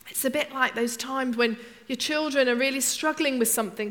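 ordinary talk

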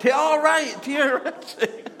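high, loud laughing repeat; laughing shout. Real, not acted. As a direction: burst out laughing as you repeat it. Mishearing the child's answer.

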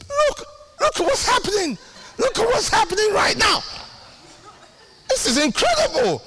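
high, wondering "Look at what's happening"; mock amazed prayer. Preacher retelling praying in amazement, high excited voice. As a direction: marvel aloud to God. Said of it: "And I say".

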